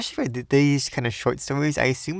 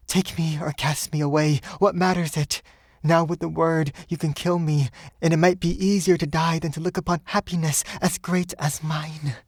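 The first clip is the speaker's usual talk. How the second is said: higher, impassioned woman's voice; devoted woman; 6 semitones higher than usual. Acted. Woman begging her lover to take her, raised and impassioned. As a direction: give yourself to him, voice lifted. Woman offers herself to him.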